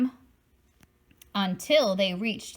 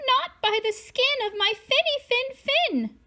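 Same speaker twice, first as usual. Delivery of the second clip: much higher, small scared fish voice; trembling little fish; 14 semitones higher than usual. Acted. Little fish answering the shark through the door, high and small. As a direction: squeak it out, brave but scared. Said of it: "The little fish trembled and replied".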